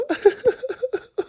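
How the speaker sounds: a real, high, uncontrolled laugh; helpless high laughter. Real, not acted. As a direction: laugh until you almost cry. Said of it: "about to cry laughing".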